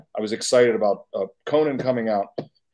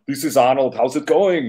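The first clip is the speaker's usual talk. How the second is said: light Austrian Arnold voice; mild Arnold Schwarzenegger accent; Arnold impression; 3 semitones higher, 4 dB louder than usual. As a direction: call in as Arnold, slightly hammed. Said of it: "So I call him".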